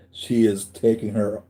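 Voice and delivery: ordinary talk, nothing marked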